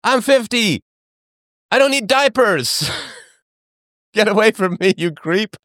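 high, laughing, repelled son; mock disgusted son. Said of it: "It's like".

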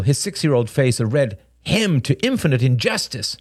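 ordinary delivery

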